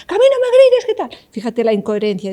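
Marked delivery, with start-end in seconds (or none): 0.1-1.1 shouting. Shown as a demonstration. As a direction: shout "Que a mí no me grites" much higher and louder. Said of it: "gritándole a alguien que no te grite". (gritando mucho más alto y fuerte)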